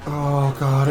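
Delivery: usual speaking voice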